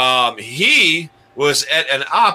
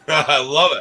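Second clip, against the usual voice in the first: normal talking voice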